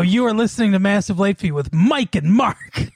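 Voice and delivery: imitated show intro, "Mike and Mark" made to sound important; punch on MIKE and MARK. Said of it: "he puts a little punch on".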